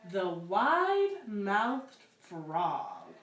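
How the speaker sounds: slow, drawn-out title reading; title announcement. A narrator's reading. Librarian presents the next book, slow storyteller announcement. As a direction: savour the title for the kids.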